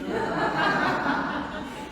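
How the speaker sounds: loud, high breathy burst of laughter; real laugh. Real, not acted. Speaker amused by own one-word answer, laughing loudly.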